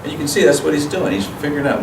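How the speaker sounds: ordinary talk, nothing marked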